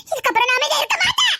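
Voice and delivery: defiant outburst, voice lifted. Acted. Same vow repeated, raised voice. (உயர்த்திய, எதிர்ப்புக் குரல்)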